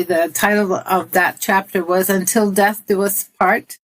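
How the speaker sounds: usual speaking voice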